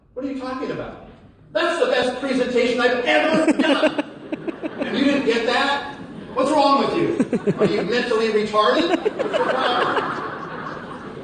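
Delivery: hammed-up outrage at a blank stare; mock incredulous. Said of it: "You're a bit incredulous".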